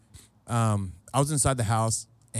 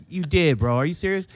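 higher, insistent comic disbelief; mock incredulous; 5 semitones higher than usual. Host pressing buddy on promise, mock incredulous. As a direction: press him in feigned disbelief.

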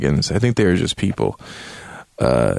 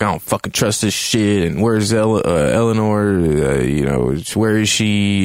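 ordinary delivery

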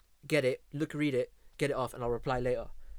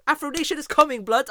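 excited; 11 semitones higher, 9 dB louder than usual. Real, not acted. Hyped announcement to friend.

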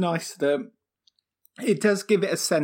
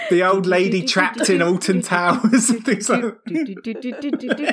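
laughing; 5 semitones higher, 6 dB louder than usual. Real, not acted. Joking about Alton Towers.

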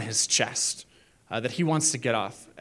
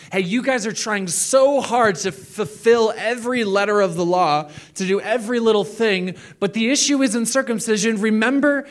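high, pressing appeal; urgent Paul; 6 semitones higher, 4 dB louder than usual. Retold or imitated. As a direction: urge them on.

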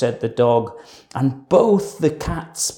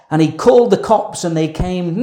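plain reading voice

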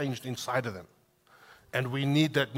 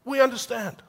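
much higher, louder cry of dawning understanding; sudden realization; 11 semitones higher, 7 dB louder, slower than usual. Retold or imitated. Pastor voices disciples suddenly understanding, loud high surprised oh. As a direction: let it hit them all at once. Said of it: "They went".

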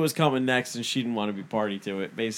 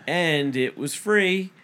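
slow, drawn-out proud boast; milk the word free like a proud brag; smug brag; 5 semitones higher, 4 dB louder, much slower than usual. Retold or imitated. Mother-in-law boasting to the neighbor, slow smug brag.

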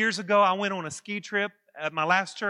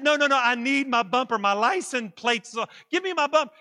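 loud, high-pitched pleading demand; urgent insistence; 6 semitones higher, 9 dB louder than usual. Retold or imitated. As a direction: demand it back at high volume. Insisting stranger return his bumper.

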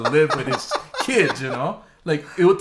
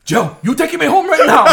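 high, loud demand through laughter; laughing mock demand; 12 semitones higher, 10 dB louder than usual. Son mimics dad demanding ride, high loud laughing. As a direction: insist on it like an impatient old man. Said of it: "He goes".

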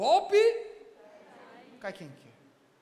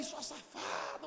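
muttered insult; 6 dB quieter, slower than usual. Retold or imitated. Marquito insulting his ex.

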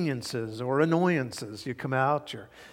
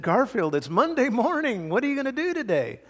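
bright, higher and louder cheery wake-up call; cheerful owner; 9 semitones higher, 3 dB louder than usual. Retold or imitated. Preacher voicing John cheerfully waking Garfield on Monday. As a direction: chirp it like a too-cheerful morning greeting. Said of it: "cheerfully, uh, looked at him and said".

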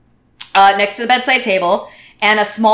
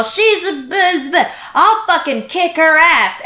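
put-on drunk threat; 6 semitones higher than usual. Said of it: "she's all".

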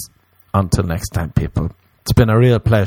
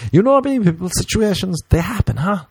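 pitched-up you know me people; high catchphrase; 9 semitones higher than usual. Pretend. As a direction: toss it off high and cheeky. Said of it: "catchphrase repeat".

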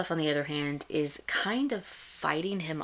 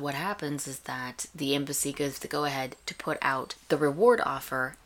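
ordinary talk

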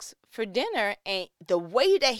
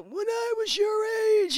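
high, shouted when-I-was-your-age; old-timer lecture; 11 semitones higher, 4 dB louder than usual. Retold or imitated. Stepdad lecturing the kids, hammed-up shout. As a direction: ham up the elder's lecture. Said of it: "giving me their".

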